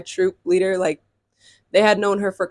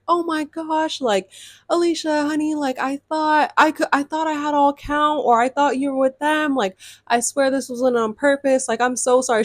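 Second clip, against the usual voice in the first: a hint of a gushing, sorry mom; apologetic mom; 6 semitones higher than usual. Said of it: "She was just super apologetic".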